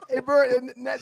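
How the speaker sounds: usual speaking voice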